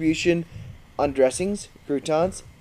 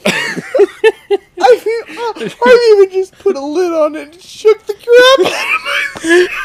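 high, loud laugh-shout, tears in the voice; shrieking laughter; 6 dB louder than usual. Real, not acted. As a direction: shriek the words through helpless laughter. Reading the question, laughing hard.

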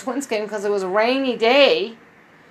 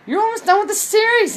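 much higher, louder mock surprise; surprised exclamation; 13 semitones higher, 5 dB louder, faster than usual. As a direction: exclaim it like a shocked friend. [name] exclaims, acted.

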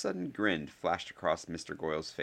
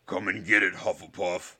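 loud, put-on bully voice; shouted taunt; 6 dB louder than usual. A narrator's reading. Goyle taunts Hufflepuff from the air, shouting. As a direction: jeer it out loud. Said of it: "shouted Mister Goyle".

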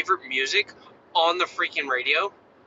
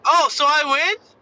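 put-on high, bright-eyed kid voice, clueless; oblivious little boy; 9 semitones higher, 5 dB louder than usual. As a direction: play the kid who has no idea what just happened. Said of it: "Like, he's so oblivious".

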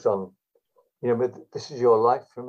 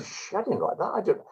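mimicking Spike's protest, voice pushed higher; a comic insisting he never wrote it, pitched up; Spike imitation, higher; 10 semitones higher than usual. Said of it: "And Spike was going".

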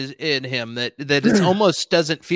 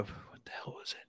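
muttered to self; 28 dB quieter than usual. Real, not acted.

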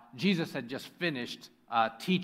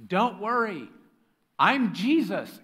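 high, loud, drawn-out call to the disciples; Jesus calling out; 5 semitones higher, 7 dB louder, slower than usual. Acted. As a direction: play Jesus announcing himself with comic cheer. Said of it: "At once Jesus said to them".

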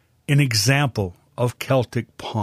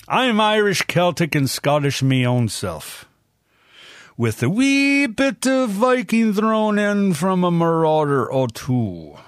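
lightly put-on Irish brogue, pitched higher; a hint of a stage Irish accent, playful and higher; bad Irish accent; 6 semitones higher than usual. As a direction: hams up a bad Irish lilt for a laugh. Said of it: "With that bad accent".

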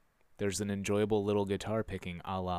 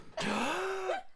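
big sharp inhaled gasp. Shown as a demonstration. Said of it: "this is what you do".

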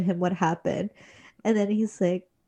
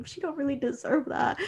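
tearful, higher and softer; crying; 5 semitones higher, 5 dB quieter than usual. Retold or imitated. Fan upset over mom in hell, near tears. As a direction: let the voice crack with tears. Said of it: "I'm like".

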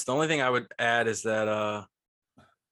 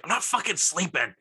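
grumpy mom; 6 semitones higher than usual. Retold or imitated. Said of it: "she's like".

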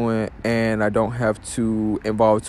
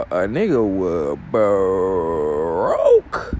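very slow, hugely stretched last word; drawn-out confession; 4 semitones lower, much slower than usual. Real, not acted. Podcaster being honest with listeners, drawn-out comic emphasis. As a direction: drag the final word out for comic weight.

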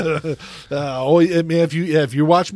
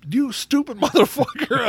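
mock insult; 10 semitones higher than usual. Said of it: "I wanna be like".

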